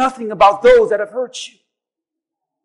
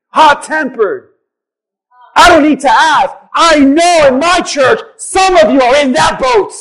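ordinary talk

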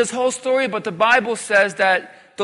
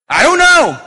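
loud surrender; 5 semitones higher, 7 dB louder than usual. Shown as a demonstration. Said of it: "Say it with me".

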